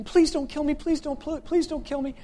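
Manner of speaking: high-pitched, rapid repeated begging; acted panicky plea for mercy, pitched well up; pleading thug. Thug held by the scruff begs Batman, high pleading. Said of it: "the guy's going".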